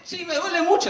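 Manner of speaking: high-pitched mock pain; hammed-up wounded man's wail; pained cry. Shot man answers the doctor, pained high cry.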